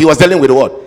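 insistent repeat. Shown as a demonstration. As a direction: press the congregation for the answer. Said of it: "No, come on".

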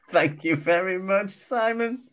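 real laughter breaking up the thanks; laughing. Real, not acted. Co-host laughing his thanks to Simon for the line. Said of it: "On me chin".

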